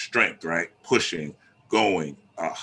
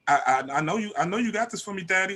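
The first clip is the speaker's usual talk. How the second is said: sweet wise woman; 10 semitones higher, faster than usual. Retold or imitated. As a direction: sweet-talk him into fixing the car. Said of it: "say it nice".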